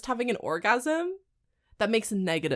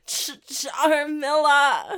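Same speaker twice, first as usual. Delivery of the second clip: drawn-out laughing name; 6 semitones higher, 6 dB louder, much slower than usual. Pretend.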